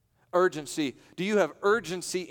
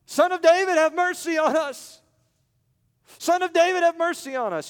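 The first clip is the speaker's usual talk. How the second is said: much higher and loud, pleading; desperate crying out; 13 semitones higher, 8 dB louder than usual. Acted. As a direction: cry out for mercy. Blind men cry out.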